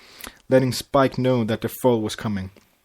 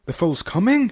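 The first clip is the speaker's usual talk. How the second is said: alarmed; 8 semitones higher than usual. A narrator's reading. Flash alarmed at labour.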